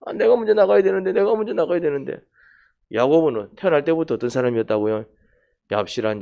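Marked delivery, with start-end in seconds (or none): 0.1-2.2 higher, fretful inner voice of the younger twin; mock-anxious Jacob hurrying out. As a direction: fret as if racing to be born first.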